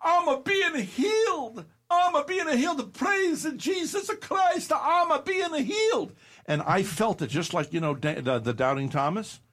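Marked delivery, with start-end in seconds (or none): 0.0-6.1 ecstatic yelling. Retold or imitated. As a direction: shout it like a woman being healed. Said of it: "she's yelling".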